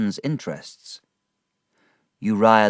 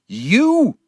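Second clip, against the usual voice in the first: high furious shout; 14 semitones higher, 9 dB louder than usual. A narrator's reading. Drunk father shouts at Uriah, high furious yell. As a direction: explode at him in rage. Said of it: "he shouted".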